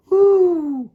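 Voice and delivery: high, loud owl whoo; owl hoot. Acted.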